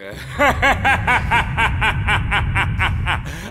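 long, rippling godlike belly laugh; pompous rolling laughter; disbelieving laugh. Acted. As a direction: laugh it off as a haughty god. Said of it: "Das kann und will Neptun nicht glauben".

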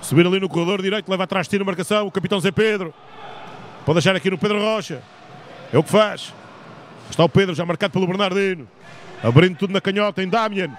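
live sports commentator calling action; play-by-play commentary. Real, not acted. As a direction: call the play as it happens.

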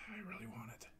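whispered aside; whispering. Real, not acted. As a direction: breathe it under your breath. Unvoiced muttering aside.

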